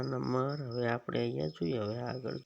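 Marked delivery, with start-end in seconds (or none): none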